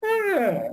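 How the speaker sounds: high shrieking demon cry. Shown as a demonstration. Preacher showing how a screeching demon sounds, high shriek. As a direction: let out a horrible screech like a demon. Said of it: "imagina lo horrible que es oír a un demonio chillando".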